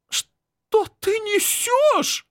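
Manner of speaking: очень высокий, медленный, чужой голос; голос срывается вверх, чужой и тягучий; strange voice. Acted. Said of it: "говорит не своим голосом". (говорит не своим голосом)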